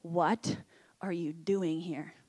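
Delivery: low, slow, hushed question; tender near-whisper, pitched well down; gentle, loving whisper. Acted. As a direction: ask it softly and lovingly, drawing it out. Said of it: "loving whisper and say".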